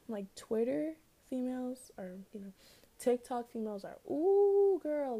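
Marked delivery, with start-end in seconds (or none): 4.1-5.2 excited. Real, not acted. Gushing over TikTok women.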